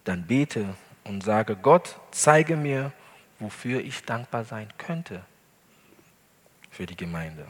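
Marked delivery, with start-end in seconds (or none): none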